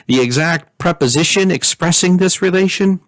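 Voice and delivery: usual speaking voice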